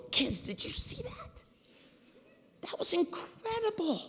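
very high, breathless mock excitement; fired up. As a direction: stretch it out in hammed-up giddy thrill. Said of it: "I'm fired up".

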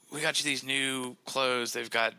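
lightly voiced parent, starting high; imitating parents. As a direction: present it like a parent to a child. Voices parents presenting new clothes.

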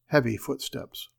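ordinary delivery

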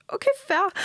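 high, laughing "okay, fair"; laughing reply. Retold or imitated. Telling a friend how she gave in to her ex, laughing. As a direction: laugh the words out as you give in. Retold reply to ex's plea.

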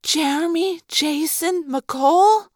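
slow, high, awestruck echo; starstruck, drawn-out question. A narrator's reading. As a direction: draw the name out high in wonder. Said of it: "Cassie echoed in awestruck tones".

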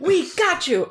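high, loud "We got you!"; triumphant bust shout. Acted. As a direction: burst in and shout it. Acted police bust.

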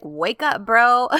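retold scolding that jumps loud on bro; mock-shout wake up, bro at a friend; mock yell. Retelling a character scolding Serkan.

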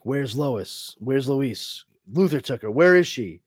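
freaking out. Retold or imitated. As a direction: demand to know where she is, voice climbing. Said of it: "he's freaking out".

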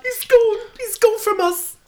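very high wailing lament; hammed-up grief. Mother losing her boy, high mock-tragic wail.

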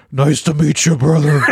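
lightly voiced wrestling heel growing into a laugh; Undertaker character voice. Wrestler greeting a fan in character, mock persona voice, laughing. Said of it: "straight into the character".

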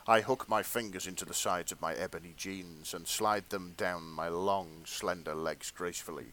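low, measured story-reading voice; hushed erotica narrator; fanfic narration. Acted. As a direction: read it like a sensual audiobook, played straight.

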